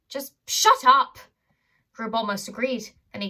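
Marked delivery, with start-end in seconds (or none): 0.1-1.2 loud, slow angry shout. Acted. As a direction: bark it out, slowly. Butler snaps at Grubb.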